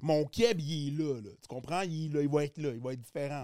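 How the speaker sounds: lightly put-on Québécois character; Québécois accent. Shown as a demonstration. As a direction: slip into a Quebec French persona. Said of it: "mon Québécois, c'est un autre personnage". (accent québécois légèrement appuyé)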